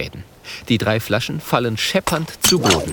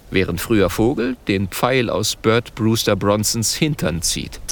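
usual speaking voice

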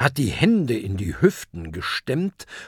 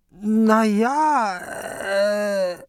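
high, hesitant hemming; caught-out kraken stuttering; stammering kraken; 10 semitones higher, much slower than usual. A narrator's reading. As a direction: stall nervously. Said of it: "stottert der Krake".

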